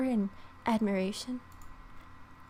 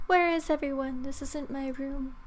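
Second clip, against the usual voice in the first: dazed confusion; 5 semitones higher than usual. Acted. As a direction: murmur it, half-awake and lost. Waking up in a strange room.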